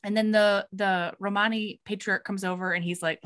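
ordinary talk, nothing marked

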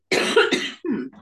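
sharp throat clear after tea. Real, not acted. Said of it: "went down the wrong way".

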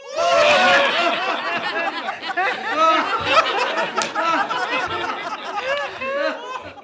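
squeaky sped-up laughing; shrill chipmunk laugh track; high cartoon laugh. Laugh track after joke.

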